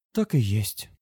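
resigned, sighing confirmation; wistful agreement; sighing admission. A narrator's reading. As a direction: confirm it with a sigh. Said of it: "вздохнув, подтвердила она".

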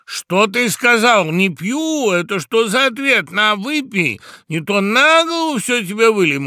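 high, loud indignant outburst; outraged toastmaster's bullying shout; threatening. A narrator's reading. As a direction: threaten him at full voice. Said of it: "угрожающе проговорил Тамада".

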